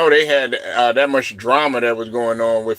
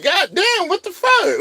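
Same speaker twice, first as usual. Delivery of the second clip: shocked disbelief. Retold or imitated. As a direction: let it crack with disbelief. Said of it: "you just be like".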